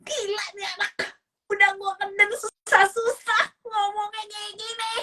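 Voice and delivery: high, strained inner rant; acted parent silently losing it; exasperated inner voice. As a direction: let the pent-up frustration squeal out. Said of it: "Dalam hati gimana?".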